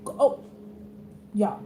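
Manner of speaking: ordinary delivery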